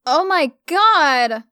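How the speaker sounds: loud, high, slow outraged shout; a girl shouting in outrage. A narrator's reading. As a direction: blast it out in disbelief. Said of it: "she shouted".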